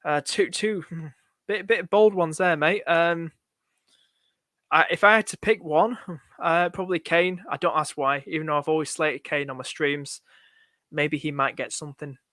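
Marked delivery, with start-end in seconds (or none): none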